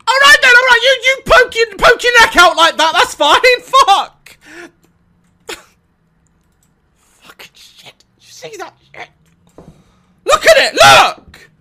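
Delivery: high panicked shriek-laugh; frantic shrill scream; scared horror game streamer. Real, not acted. Scared by creature poking out.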